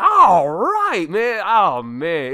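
high, roaring touchdown whoop easing off; huge celebratory holler, drawn out; loud sports cheer. Retold or imitated. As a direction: imitate granny yelling at the game. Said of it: "she would be so loud".

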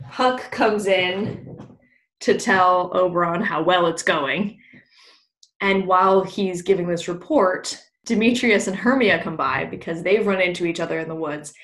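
ordinary talk, nothing marked

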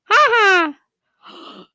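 high falsetto ha ha from the song; imitating song laugh. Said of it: "when he goes".

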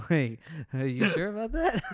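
laughing through the question, pitched high. Real, not acted. Host laughing at the clueless soldier, giggling. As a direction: giggle out a mock doubtful question.

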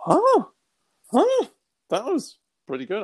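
be struck with wonder; amazed. Retold or imitated. Hearing the words I've chosen you, high amazed delight.

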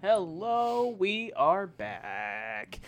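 ordinary talk

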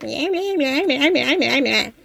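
mock whiny meh meh bleats; whiny moaning.

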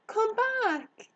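high, loud call after someone. Acted. Girl to the fleeing hare, calling out. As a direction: call it urgently into the distance. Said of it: "called Harriet".

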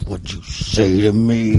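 slow, low, quiet threat; low and slow menace; menacing dad. Retold or imitated. Dad holding his kids by the head, low threat. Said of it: "and be like".

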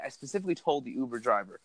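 ordinary delivery

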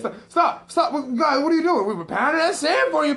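high, loud hammed-up alarm; high loud mock panic; mock panicked stepdad. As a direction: squeal at them to stop. Stepdad alarmed, kids taunt.

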